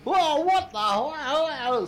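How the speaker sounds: high, slow startled cry; alarmed outburst. Retold or imitated. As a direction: freak out as the truck cuts in. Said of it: "Murphy's freaking out".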